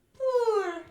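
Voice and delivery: very high, loud wailing poor; high loud cry. Shown as a demonstration. Teacher modelling the sob for her student, high loud wail. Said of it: "take your time".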